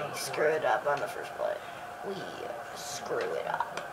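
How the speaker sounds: low, near-breathless hush; sinking low murmur of dread. Real, not acted. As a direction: drop your voice down almost to nothing. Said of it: "Why am I talking in this voice?".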